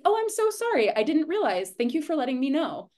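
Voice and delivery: high-pitched sweet apology easing into thanks; gracious apology. Acted. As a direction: act out an easy, grateful sorry, voice lifted. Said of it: "have the grace to be like".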